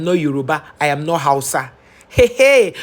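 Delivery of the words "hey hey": high, loud hey hey; sharp loud interjection; loud exclamation. Real, not acted. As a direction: call it out in disbelief. Exclaiming at the hypocrisy.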